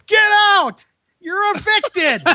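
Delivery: high-pitched comic eviction shout; imitated yelling. Imitating assistant evicting mice from a box, high yell. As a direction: yell it way up high, as if ordering out intruders. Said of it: "starts yelling at him".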